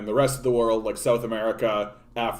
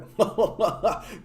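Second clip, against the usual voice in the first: high laugh; 8 semitones higher than usual. Real, not acted. Laughing at own joke.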